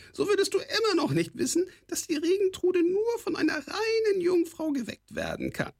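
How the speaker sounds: very high goblin voice full of scorn; a scornful goblin's squawk; sneering kobold rasp. A narrator's reading. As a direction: talk down to him like a mocking goblin. Kobold continues.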